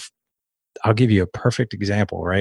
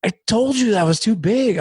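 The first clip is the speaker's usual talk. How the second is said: very high, exasperated scolding, retold; strained, high-pitched mock outburst; losing cool; 12 semitones higher than usual. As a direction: re-enact a frustrated dad telling off his kid. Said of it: "lost my cool on my four-year-old".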